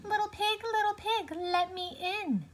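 wolf putting on a granny voice; wolf as old woman. A narrator's reading. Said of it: "He was dressed as an old woman".

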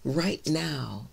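normal talking voice

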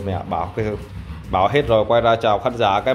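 normal talking voice

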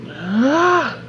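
high, loud frantic burst; flustered outburst. Real, not acted. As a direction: blurt it out in panic. Frantic, lost in puzzle.

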